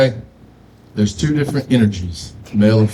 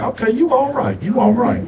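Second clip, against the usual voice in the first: cats' approval; 10 semitones higher than usual. Retold or imitated. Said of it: "And they be like".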